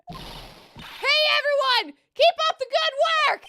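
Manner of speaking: high, loud shouted cheer; over-the-top high-pitched shout; calling out. Acted. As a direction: holler encouragement across a crowd. Acted calling out to investigators.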